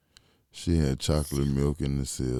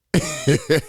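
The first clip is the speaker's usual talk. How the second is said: a high, loud burst of laughter; 14 semitones higher, 8 dB louder than usual. Real, not acted. Laughs at his friend.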